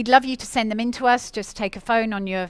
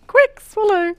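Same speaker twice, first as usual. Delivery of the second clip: laughing high through the words; 4 semitones higher, 7 dB louder than usual. Real, not acted. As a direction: giggle it out. Teasing cohost mid-bite.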